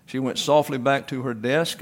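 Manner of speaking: ordinary delivery, nothing marked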